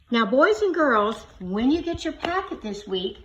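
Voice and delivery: normal talking voice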